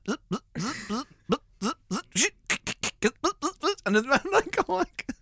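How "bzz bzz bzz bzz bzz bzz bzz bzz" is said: short high robotic zaps; imitated servo buzz. Imitating the animatronic rabbit's head, mechanical buzzing. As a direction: buzz like tiny motors, each rising. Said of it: "you can't hear anything coming from the rabbit apart from".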